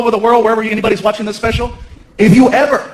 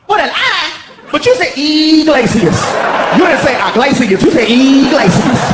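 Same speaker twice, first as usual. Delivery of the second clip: higher, put-on voice of a grumpy hotel clerk; sassy night clerk; 3 semitones higher, slower than usual. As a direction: snap at the late guest like a fed-up clerk. Clerk confused, indignant.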